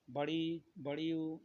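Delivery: ordinary talk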